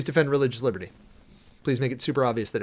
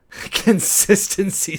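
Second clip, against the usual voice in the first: laughing; 8 semitones higher, 7 dB louder, much slower than usual. Real, not acted. Reading chat comment, laughing.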